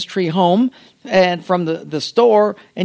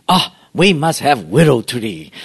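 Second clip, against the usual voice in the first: exaggerated Japanese-accented broken English; mock bonsai master; 2 semitones lower than usual. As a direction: announce a bright idea as a mock master. Mocks pastors in the master's voice.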